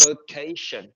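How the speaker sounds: "vacation" as "vuh-KAY-shun", stressed on KAY; stress on second syllable. Shown as a demonstration. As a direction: lean on the "ca" in "vacation". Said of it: "nó sẽ rơi vào chữ K".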